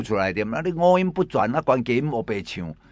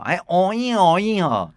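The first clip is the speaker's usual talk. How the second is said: siren imitation; 7 semitones higher, 4 dB louder than usual. Radio host imitates siren.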